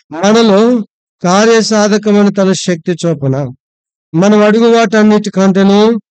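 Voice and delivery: high, loud, declaiming the verse; loud scripture reading. Acted. Pastor reading a Bible verse to his congregation, loud and declaiming. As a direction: proclaim the verse to the whole church.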